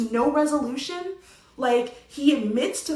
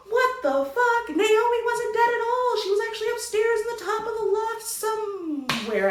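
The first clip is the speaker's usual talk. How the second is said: incredulous outburst; 8 semitones higher than usual. Real, not acted. Reviewer revealing Naomi wasn't dead, incredulous.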